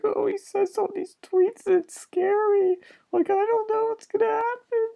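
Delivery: mock a fearful crybaby, high and whimpering; mock tearful Omarosa. Mocking Omarosa crying about Trump's tweets, high whiny mock sob. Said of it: "crying about Trump".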